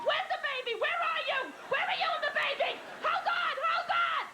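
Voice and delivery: very high loud frantic questions; high shrieking panic; panicked sister. Retold or imitated. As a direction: shout in alarm, half asleep.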